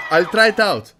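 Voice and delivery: a sudden loud shout of "I'll try it out". Shown as a demonstration. Host testing a viewer's suggested opener, shouting "I'll try it out". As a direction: belt "I'll try it out" out like a test take.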